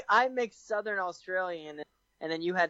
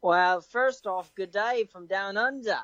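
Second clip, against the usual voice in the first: put-on Australian accent. Contestant opening an argument in the accent game, amateur Australian accent. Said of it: "in Australian accent".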